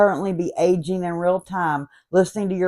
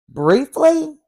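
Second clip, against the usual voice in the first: incredulous; 8 semitones higher, 4 dB louder than usual. Acted. Incredulous at robot's request.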